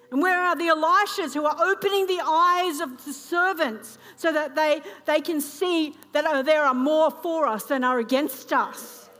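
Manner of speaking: loud, rising sermon delivery; preaching. Acted. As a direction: preach it with full force. Preaching at full force.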